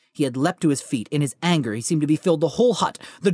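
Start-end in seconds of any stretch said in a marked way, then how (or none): none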